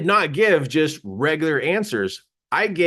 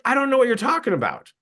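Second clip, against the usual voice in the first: quick, high-pitched denial; baffled denial; 8 semitones higher, faster than usual. Retold or imitated. Speaker tells audience he's clueless, baffled denial. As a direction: protest cluelessness fast and high.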